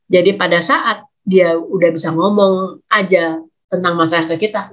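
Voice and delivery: ordinary talk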